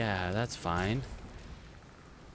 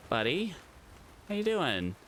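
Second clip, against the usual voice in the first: cooing at a pet; 5 semitones higher than usual. Real, not acted. Greeting an animal in game.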